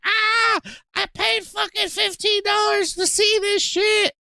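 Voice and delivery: high, shouted complaint from a ripped-off fan; angry viewer yell. Retold or imitated. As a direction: yell it like you paid for nothing. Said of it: "and people are like".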